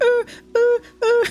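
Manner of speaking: high whimpering dog impression; imitating dog whine. Imitating her dog whining at the neighbor's dog. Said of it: "he'd just be crying".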